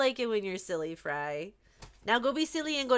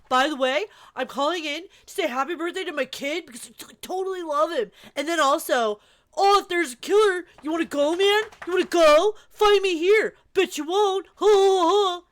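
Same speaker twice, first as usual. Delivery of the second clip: louder, higher macho taunt, cocky ha ha ha; mock tough-guy caller; 4 semitones higher, 6 dB louder than usual. To chat, mimicking the game's caller, mock tough-guy taunt. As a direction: puff up like a swaggering caller picking a fight. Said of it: "That's such a good impression".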